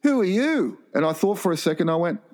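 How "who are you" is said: high, challenging mock voice; imitated indignant customer; confrontational customer. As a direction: challenge him with a raised pitch. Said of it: "he looks at me and he goes".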